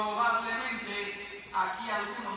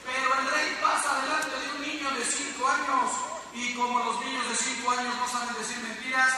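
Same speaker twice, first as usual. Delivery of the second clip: ordinary talk, nothing marked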